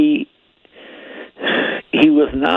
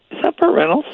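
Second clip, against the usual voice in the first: Burt Reynolds impression; faster than usual. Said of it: "He did a great Burt Reynolds".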